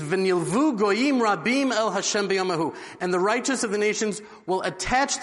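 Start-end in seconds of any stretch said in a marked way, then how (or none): 0.0-2.7 slow, measured Hebrew verse recitation with the word "goyim" lifted; solemn recitation. Shown as a demonstration. Rabbi quoting the prophet to the congregation, slow solemn intoning. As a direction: intone the scripture slowly and reverently. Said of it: "He says".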